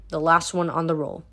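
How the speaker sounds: plain reading voice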